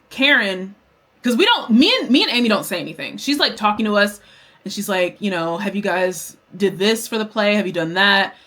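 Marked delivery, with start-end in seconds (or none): none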